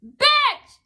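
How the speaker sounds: high, loud mock shout; imitated yell. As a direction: burst out like a passing driver's insult. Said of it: "and I heard, like".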